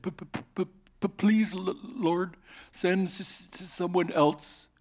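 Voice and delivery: high, halting stutter, begging; stuttering plea. Acted. Moses begs God to send someone else, stuttering. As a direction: stammer out the plea.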